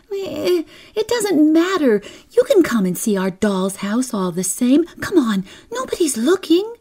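put-on high, quick, insistent little girl; coaxing child. Kezia coaxes reluctant Lil to come, high and eager. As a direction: urge a friend along, brushing off the objection.

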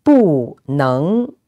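"不" falls, then "能" rises; fourth-tone bu. Shown as a demonstration. Teacher giving a phrase to repeat, "不" falling, "能" rising. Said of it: "bu, with the fourth tone".

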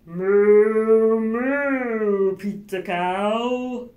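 loud, slow mooing cow voice. Acted. Greeting the pizza cow at the door, loud slow moo. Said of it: "Then what would you say?".

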